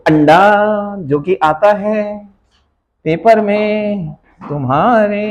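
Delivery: slow, stretched-out words; drawn-out teasing. Real, not acted. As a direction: tease the class by dragging each word. Teasing students about a zero mark. (धीरे, खींच-खींचकर चिढ़ाना)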